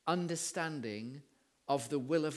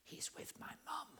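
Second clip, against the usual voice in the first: put-on very soft, secretive child whisper; little boy's whisper; 12 semitones higher, 13 dB quieter than usual. Little boy telling the boss where dad is, whispering. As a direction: whisper like a little one in hiding. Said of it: "and then the little voice said".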